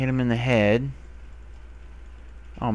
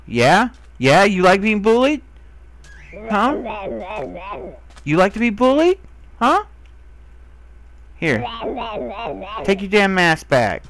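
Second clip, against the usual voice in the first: louder and higher, taunting jeers; mock bully taunt; 7 semitones higher, 4 dB louder than usual. Real, not acted. To the mob that took his mask, a loud mocking taunt. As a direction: jeer at the creature like a schoolyard bully.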